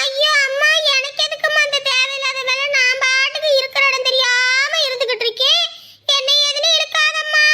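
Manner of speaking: overacted innocence. Mother-in-law protesting her innocence to daughter-in-law, hammed-up wail. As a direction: play the wronged old woman, overdoing it. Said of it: "ரொம்ப நடிக்காதீங்க அப்படியே".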